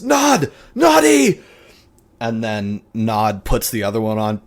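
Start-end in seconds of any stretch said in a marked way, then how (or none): 0.0-1.4 calling an assistant, high and loud; urgent shout. Acted. Scientist calling his assistant for help, urgent high shout. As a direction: shout the name in panic. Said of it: "and says".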